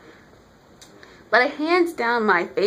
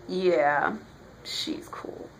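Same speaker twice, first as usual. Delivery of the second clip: low, dropping to a whisper; quiet, understated awe; hushed admiration; 6 semitones lower, 7 dB quieter, much slower than usual. Retold or imitated. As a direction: breathe it out like an impressed fan. Acts out reader's awed reaction.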